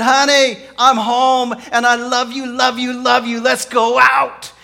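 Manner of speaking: high, very loud, overjoyed husband; hammed-up romantic shouting; gushing greeting. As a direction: belt out love with over-the-top enthusiasm.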